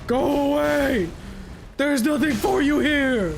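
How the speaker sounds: yelling. Real, not acted. Escalating yell at enemy.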